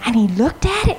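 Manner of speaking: ordinary delivery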